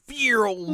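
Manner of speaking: high, loud whooshing vroom; zooming vroom. Retold or imitated. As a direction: imitate someone zooming off. Imitates Yo zooming away.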